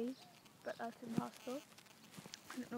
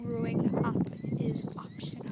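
bursting out louder, pitch leaping up on optional; loud quoted punchline; 8 dB louder than usual. Acted. Declaring a favourite quote to viewers, loud and soaring. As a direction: proclaim the end of the quote. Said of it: "I think that's the quote".

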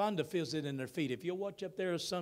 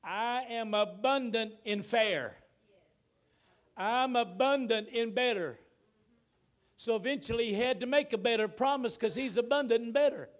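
full-force preaching; 7 semitones higher, 7 dB louder than usual. Acted. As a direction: proclaim it to the whole church. Preacher building the sermon loudly.

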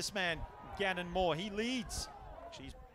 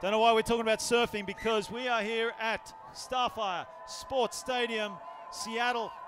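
higher, louder broadcast announcer voice; sports announcer reintroduction; 6 semitones higher, 6 dB louder than usual. Real, not acted. Commentator welcomes viewers back with the score, big announcer voice. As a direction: announce the score and hosts, voice lifted and projected.

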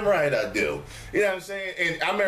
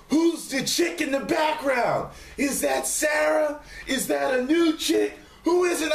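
gossipy viewers; 13 semitones higher than usual. Retold or imitated. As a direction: pester him like excited fans. Mimicking excited viewers.

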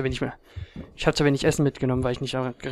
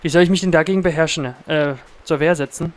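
being chased; 3 semitones higher, 7 dB louder than usual. Real, not acted. Noch immer von Wölfen angegriffen.

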